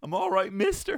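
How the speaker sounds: high, loud confused insisting; dazed pleading lad. Retold or imitated. Hallucinating lad telling the teacher he's fine, high and loud. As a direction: insist you're fine while totally out of it. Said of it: "saying to the teacher".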